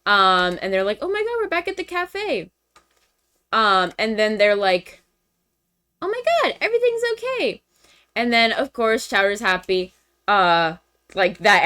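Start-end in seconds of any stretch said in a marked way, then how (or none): 1.0-2.5 high, softened squeal; mock delight. Voicing friends glad to be back. 6.0-7.6 very high, squeaky put-on voice; mock relief. Voicing friends' relief.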